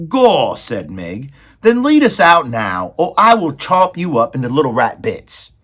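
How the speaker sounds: loud, high defiance; defiant maid. Acted. Meg defying Roscuro, loud and high. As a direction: stand up to the rat.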